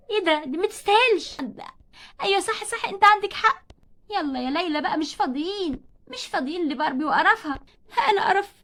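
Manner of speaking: ordinary talk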